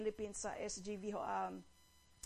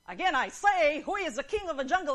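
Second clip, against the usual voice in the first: loud, high, rapid lion demand; blustering lion at full volume; angry growling lion; 5 semitones higher, 10 dB louder than usual. Acted. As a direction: repeat it furiously, in mock rage. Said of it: "And growled".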